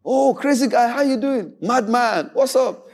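high, lively mock street greeting; boisterous greeting. Preacher acting out how youths greeted each other, boisterous. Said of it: "the way we used to greet ourselves".

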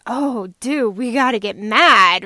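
loud mock pep talk, "mad" said loudest; brain hyping itself up. Mom voices her brain gearing up for a fight, loud. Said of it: "our brain processes".

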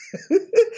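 a sudden high laugh; short bright laugh of relief; high burst of laughter. Real, not acted. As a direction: laugh out, lightly amused. Laughs at her own line.

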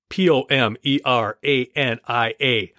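slow, careful letter-by-letter spelling of a tricky name; spelled out letter by letter. Real, not acted. Podcast host spelling a listener's town he can't say, slowly.

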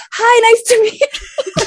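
high, loud, drawn-out fangirl gush; imitating an overexcited hello; starstruck greeting. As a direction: squeal it with a laugh, like a giddy fan. Retold starstruck greeting after awkward touch.

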